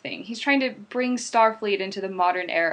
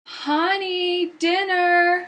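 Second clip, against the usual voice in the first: calling out; 7 semitones higher, much slower than usual. Acted. Wife calling husband to dinner.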